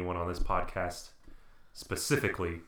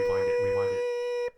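very high squeaky rewind voice; rewind effect; 7 dB louder than usual. Pretend. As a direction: sound like a tape rewinding. Joking tape rewind effect.

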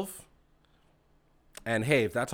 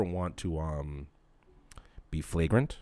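dropped low and softer; 7 semitones lower, 5 dB quieter than usual. Real, not acted. Insisting he's no asshole, suddenly low and soft. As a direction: sink the voice right down. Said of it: "I don't know why I got so low".

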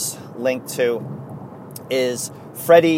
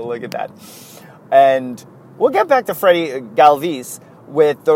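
normal talking voice

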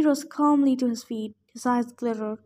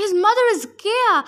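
higher, louder urgent warning; yelled warning; 8 semitones higher, 5 dB louder than usual. A narrator's reading. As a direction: shout it before she's silenced. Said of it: "Annabeth yelled at me".

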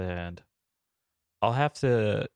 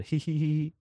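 mock villain giggle; 8 semitones higher than usual.

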